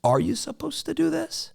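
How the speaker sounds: a sudden doubt about a rule; doubting question. Retold or imitated. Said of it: "you're like".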